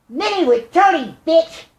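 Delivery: loud, high call. Shown as a demonstration. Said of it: "when I raise my voice and I say".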